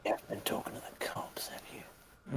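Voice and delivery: menacing whisper of the quote; whispered movie line. Retold or imitated. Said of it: "you wanted us to do the line".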